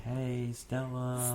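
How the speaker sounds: long drawn-out call, held back in volume; mock yell. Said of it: "and yelling".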